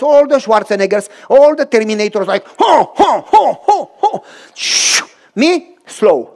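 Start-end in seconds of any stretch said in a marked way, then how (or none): 2.6-4.2 loud, pumped-up grunting "Ho, ho"; macho swimming grunts. Retold or imitated. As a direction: puff out chest and grunt each stroke. Macho swimmers racing past.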